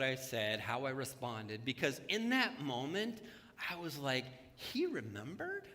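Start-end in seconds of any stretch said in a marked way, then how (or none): 4.6-5.6 high, rising in disbelief; amazed. Retold or imitated. Pastor recalling his own amazement, high and disbelieving. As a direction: marvel that he remembered.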